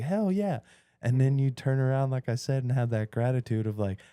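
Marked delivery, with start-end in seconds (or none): 0.0-0.6 high, enthusiastic hell yeah; cheerful mock enthusiasm; eager agreement. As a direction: jump at the invite. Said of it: "And I was like".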